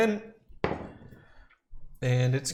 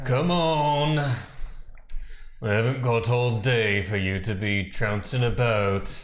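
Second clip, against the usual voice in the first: raised, urgent in-character call; 3 dB louder than usual. Acted. Player's character hollers at a dawdling ally, calling out. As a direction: call out across the fight, loudly. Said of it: "you call out like that".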